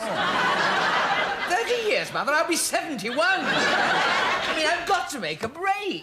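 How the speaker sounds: exasperated outburst. Acted.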